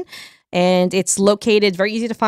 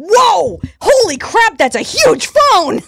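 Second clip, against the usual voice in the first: high loud whoa; surprised; 9 semitones higher, 4 dB louder, slower than usual. Real, not acted. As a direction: gasp of delight at the phone.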